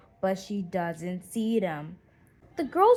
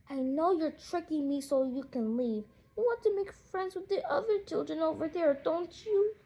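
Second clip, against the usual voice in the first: a touch of sadness, higher and hurt; a hint of a teary little girl; lightly sad girl; 6 semitones higher than usual. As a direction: accuse them gently, close to tears. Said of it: "she says sadly".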